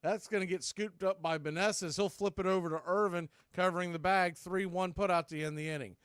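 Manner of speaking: ordinary talk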